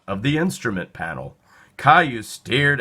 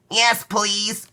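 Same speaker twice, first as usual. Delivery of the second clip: put-on child voice; 10 semitones higher than usual. Child answers eagerly.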